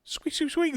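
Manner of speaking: high squeaky mimicked bat noise; imitated squeak. Storyteller mimics the floorboard squeak, high squeaky chirps.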